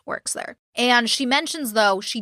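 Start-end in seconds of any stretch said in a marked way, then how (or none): 0.1-0.6 whispered scare quotes, much softer; sarcastic air quotes. Real, not acted. Host doubting Casey's job claim, in a whispered sarcastic aside. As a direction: breathe the words like they're fake. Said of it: "she, quote".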